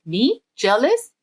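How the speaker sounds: incredulous echo. Acted. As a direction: throw "Jealous?" back like you can't believe it. Child echoes in disbelief.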